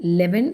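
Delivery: "Eleven" begun on L, as leven; wrong pronunciation. Shown as a demonstration. Teacher explains the mistake, "Eleven" without its first vowel. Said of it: "ല് ആണ് നമ്മൾ start".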